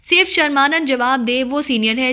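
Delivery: ordinary delivery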